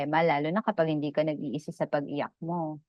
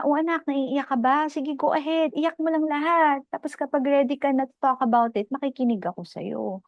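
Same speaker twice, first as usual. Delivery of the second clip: put-on higher-pitched parent inviting a child to cry; acted parental reassurance, pitched up; lightly voiced coaxing parent; 8 semitones higher than usual. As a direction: coax in a raised, encouraging voice.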